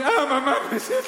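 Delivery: vocecita aguda y quejumbrosa; niño quejoso. Pretend. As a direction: finge estar enfermo. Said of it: "Y el niño". (queja aguda de niño que se hace el enfermo)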